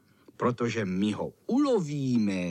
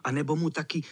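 usual speaking voice

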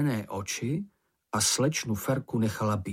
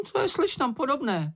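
high, squeaky little voice; remarking sidekick; 13 semitones higher than usual. A narrator's reading. Čenich commenting on the young lady's bad aim, high squeaky remark. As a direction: comment in a high, piping character voice. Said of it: "poznamenal Čenich".

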